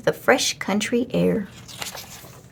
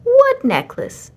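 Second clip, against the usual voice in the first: much higher, slow silly goose voice; a goose who has no idea, voiced very high; high drawn-out goose puzzlement; puzzled goose; 13 semitones higher, 5 dB louder, slower than usual. A narrator's reading. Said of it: "said Amelia".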